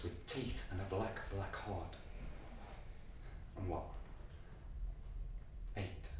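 hushed. Acted. Boy's hushed inner monologue.

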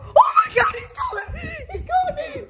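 high alarmed shout; panicked yell. Real, not acted. Warning friends the owner is coming, panicked. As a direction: sound the alarm.

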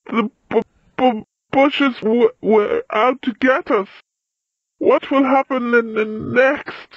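high, jumpy stuttering; upset stammer. A narrator's reading. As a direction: stick on first sounds, frightened of what follows. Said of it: "so upset that he began to stammer".

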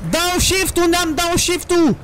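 high-pitched, stretched outcry; frantic cry. Real, not acted. Driver hunting for the downshift key, frantic.

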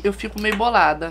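usual speaking voice

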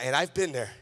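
ordinary talk

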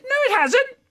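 very high, loud protest; squeaky denial. Acted. Boy denying to dad he lied, squeaky. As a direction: squeak out an indignant lie. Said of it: "your voice has gone squeaky".

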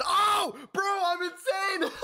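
high, slow, laughing yell; real overjoyed scream of triumph; ecstatic shout. Real, not acted. Winning second flip.

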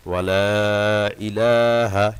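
ওয়ালা with the লা held for three alifs; very slow, drawn-out ওয়ালা; long lam. Shown as a demonstration. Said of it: "লামের উপর তিন আলিফ টান". (লামে তিন আলিফ টান)

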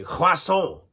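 French "croissant" with throaty R; French accent. Shown as a demonstration. Teaching a French accent warm-up, French pronunciation. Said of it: "French now".

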